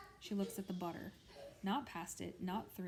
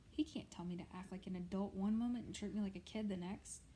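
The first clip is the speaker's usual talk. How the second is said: ordinary delivery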